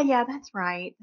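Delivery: ordinary talk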